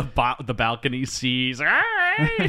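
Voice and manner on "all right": high-pitched, stretched retold exclamation; acted-out excited cry. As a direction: leap high in pitch, imitating the character. Mimes excited reaction at balcony.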